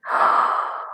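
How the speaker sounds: quick shocked intake of breath; sharp gasp. Retold or imitated. Retelling the man's remark, a breathy gasp. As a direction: gasp in mock alarm at the news.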